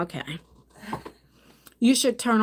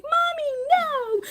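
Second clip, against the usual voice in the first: put-on high, slow pleading child voice; a tearful little girl begging her mom; crying child; slower than usual. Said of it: "Lexi was like".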